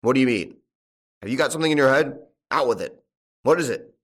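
loud, insistent questioning; impatient demand. A narrator's reading. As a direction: press hard for an answer. Farmer presses the speaker impatiently.

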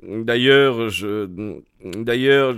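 halting, broken-off start; hesitation. Acted. Mortimer reluctant to reveal the facts to Holmes, hesitant. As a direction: falter and restart, leaving it unfinished. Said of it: "hésitation".

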